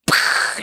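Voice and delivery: spluttered burst of air, voiceless; mouth-made stabbing noise. Retold or imitated. Storyteller miming a razor skewering someone, sputtered noise. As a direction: sputter out a skewering sound effect.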